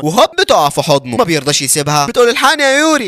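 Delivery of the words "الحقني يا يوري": high-pitched call for rescue; acted plea for help. Girl calling a friend to rescue her, urgent.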